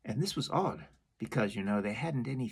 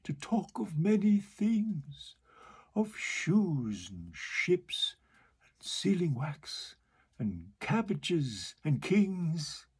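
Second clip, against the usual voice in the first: slow, half-whispered walrus list; musing walrus; 3 semitones higher, slower than usual. Acted. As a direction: linger over each odd item, whispering. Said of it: "the walrus said".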